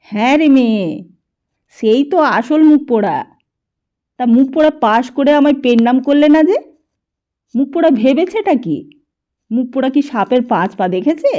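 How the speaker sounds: loud, high furious rant; a cranky grandfather working himself up; ranting old man. A narrator's reading. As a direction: grandfather raging at the boy's ingratitude. Grandfather ranting about Dipu.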